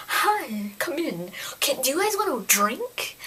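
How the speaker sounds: soft, sweetly polite imitation; quiet, sugary mock welcome; mock polite hostess. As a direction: coo it like an over-nice hostess. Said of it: "he made her seem polite".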